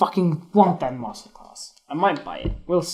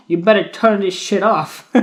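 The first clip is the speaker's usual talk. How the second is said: laughing; 4 semitones higher, 5 dB louder than usual. Real, not acted. Joking to Instagram viewers.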